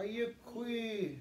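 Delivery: slow, carefully modelled Ayukwi; Yurok greeting. Shown as a demonstration. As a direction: say Ayukwi clearly for learners to repeat. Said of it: "Can you guys say that with me?".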